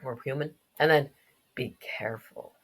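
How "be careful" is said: soft, low whisper; hushed warning. Acted. Game character warning the kid to be careful, whispered. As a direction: whisper it as a caution.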